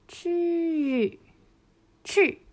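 去 started high and dropped fast, twice; 去 begun high, then a steep fall. Shown as a demonstration. Teacher showing a high start for the fourth tone, falling. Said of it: "最初はちょっと高めですね".

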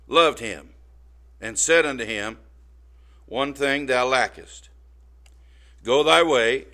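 loud, solemn King James reading; scripture reading. Acted. Preacher reading scripture to his congregation, solemn and loud.

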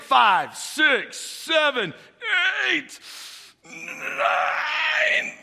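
counting reps with mounting strain, climbing to a squeal; straining under the weight. Acted. Lifter counting his last bench reps, straining harder on each.

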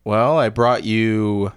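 normal talking voice

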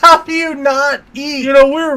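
high, loud disbelief; exasperated shout. Retold or imitated. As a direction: yell at her in disbelief. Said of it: "I'm like".

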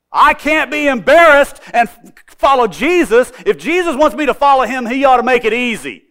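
high, loud mocking of a whiny excuse; mock the prideful complaint, high and loud; proud excuse. Preacher mocking the woman's prideful excuse, high and loud.

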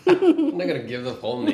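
laughing through the words. Real, not acted. Laughing refusal while eating.

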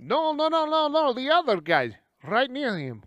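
excited correction. Acted. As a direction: rush to point out the other guy. Excited recognition of someone.

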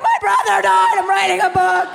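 high, loud crying shout; a loud, high, tearful mock yell; mock sobbing shout. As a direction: blurt it out in a hammed-up sob. Sobbing answer to strangers in airport.

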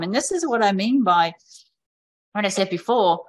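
normal talking voice

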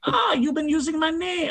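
high, put-on villain accent, badly faked; a clumsy stab at the Mandarin's accent; bad mock accent. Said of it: "I can't do voices like that".

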